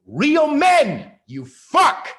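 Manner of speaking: loud furious shout; yelling in real frustration; ranting shout. Real, not acted. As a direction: bellow it at the men.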